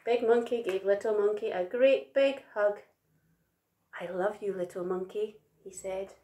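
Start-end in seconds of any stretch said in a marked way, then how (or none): 4.0-5.4 soft, gentle voiced words; tender. A narrator's reading. Big Monkey hugging Little Monkey, tender. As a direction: say it lovingly, quietly.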